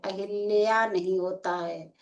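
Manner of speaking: ordinary delivery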